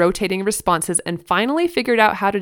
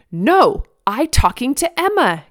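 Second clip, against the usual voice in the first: higher, louder mock toddler anger; imitated angry reply; 6 semitones higher, 4 dB louder than usual. A narrator's reading. Toddler correcting mom about sister, mock angry reply. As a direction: snap back like a cross two-year-old. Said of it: "came his angry p-, reply".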